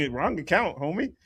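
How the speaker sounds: ordinary delivery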